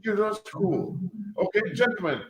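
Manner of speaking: ordinary delivery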